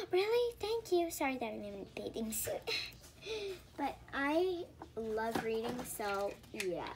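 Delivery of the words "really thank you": excited gasp. Acted. Kid told she can invite a friend, excited gasp. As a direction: gasp in delight, much higher.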